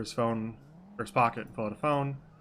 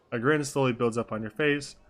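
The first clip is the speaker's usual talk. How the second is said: usual speaking voice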